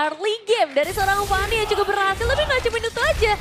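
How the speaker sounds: high, loud hyped analysis; excited commentary. Real, not acted. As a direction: ride the hype while explaining. Co-caster hyped over play.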